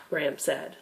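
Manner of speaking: ordinary delivery, nothing marked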